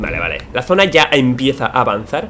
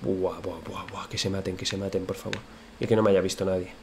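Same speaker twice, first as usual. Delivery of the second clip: muttering quietly; 5 semitones lower, 9 dB quieter than usual. Real, not acted.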